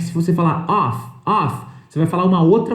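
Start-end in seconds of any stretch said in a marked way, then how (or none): 0.7-1.1 "off" said with an open o and a clear f at the end; "off" with an F sound. Shown as a demonstration. Teacher contrasting "off" with "of" for students, ending on a hard f. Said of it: "se você falar com F".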